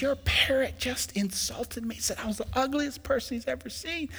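high, soft wounded complaint; insulted customer. Acted.

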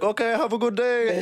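high, put-on German farewell; German accent. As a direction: sign off in the fake German accent. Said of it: "keep this charade on".

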